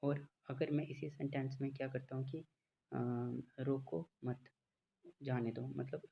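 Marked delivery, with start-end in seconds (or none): 3.6-5.7 रोको मत as one unit, long pause, then जाने दो; pause after मत. Shown as a demonstration. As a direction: join रोको to मत, stop, then release जाने दो. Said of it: "मत के बाद पॉज ले लेता हूँ".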